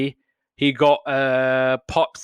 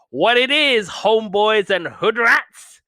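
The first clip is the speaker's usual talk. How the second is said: high, loud, drawn-out mock greeting; hammed-up attempt at hip slang; attention-getting call; 8 semitones higher, 5 dB louder, slower than usual. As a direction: holler it over a noisy crowd, hamming it up. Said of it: "trying to get their attention".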